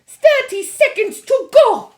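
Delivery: very high, loud witch cry. A narrator's reading. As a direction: shriek the countdown gleefully. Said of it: "cried the Grand High Witch".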